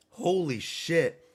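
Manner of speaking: drawn-out amazed exclamation; slow stunned repeat; slow awed holy shit. Real, not acted. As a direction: stretch it out slowly in disbelief. Repeated slowly in awe.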